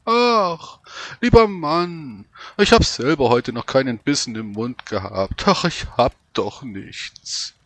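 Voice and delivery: put-on high, whining old woman; whiny voice. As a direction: whimper like a stingy old woman. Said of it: "Mit weinerlicher Stimme".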